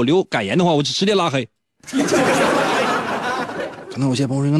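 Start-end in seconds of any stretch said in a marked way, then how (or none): 1.9-3.9 high, breathy burst of laughter; laughing. Real, not acted. Host laughing at his own teasing, real laugh. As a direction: laugh at your own joke.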